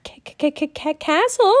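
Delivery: put-on high, stuttering frightened boy; scared boy tripping over his words; frightened stammer. A narrator's reading. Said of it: "said in a frightened voice".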